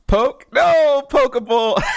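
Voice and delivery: high, loud excited yell; excited shout. Real, not acted.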